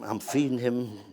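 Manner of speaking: usual speaking voice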